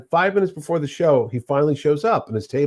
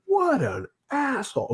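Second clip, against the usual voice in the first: high but soft mock outrage; mock yell; 12 semitones higher, 6 dB quieter than usual. Wife defending her devastated husband, mock yelling. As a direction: stretch it out high, indignant without volume. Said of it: "So she's yelling at him".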